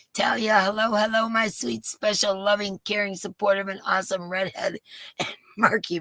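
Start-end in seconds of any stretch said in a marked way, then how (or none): none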